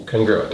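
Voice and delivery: usual speaking voice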